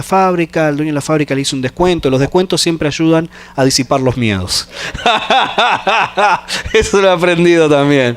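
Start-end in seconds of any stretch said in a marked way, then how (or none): 4.7-6.7 risita divertida tras la broma; ríe un momento, divertido; risa breve. Real, not acted. (risa alegre tras bromear con los descuentos)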